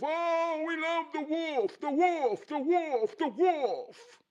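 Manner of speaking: high-pitched fan chant, put-on voice; adoring crowd. Crowd loving the basketball wolf, adoring chant. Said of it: "everybody love the wolf".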